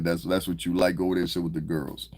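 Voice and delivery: ordinary talk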